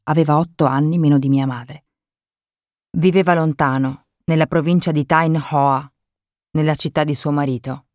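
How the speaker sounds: plain reading voice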